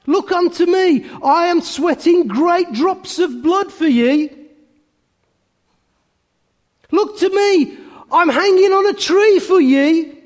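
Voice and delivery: high, urgent preacher's cry; impassioned plea. Acted. Cobbler speaks as Christ on the cross, high impassioned cry. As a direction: cry out as Christ pleading with sinners.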